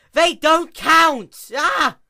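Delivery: very high, loud, slow yell. Acted. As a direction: scream each word out, drawn long. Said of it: "Luna yelled".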